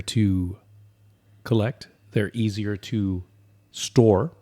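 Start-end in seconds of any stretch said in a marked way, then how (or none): none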